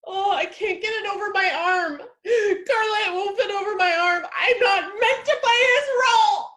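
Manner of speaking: high, loud wailing sobs building to a shout; hysterical crying. Acted. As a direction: fall apart in tears. Coat won't fit, breakdown.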